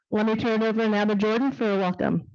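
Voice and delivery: ordinary talk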